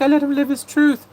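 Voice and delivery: mocking quote. Vlogger mocking the husband's excuse, high and loud. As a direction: squeal his excuse in a silly high voice. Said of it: "He's like".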